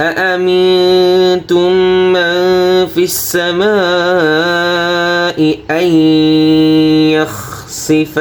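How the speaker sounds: السماء slow with the long a held four counts; four-beat madd. Shown as a demonstration. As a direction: hold السماء for four beats. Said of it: "এখানে চার আলিফ টান হবে".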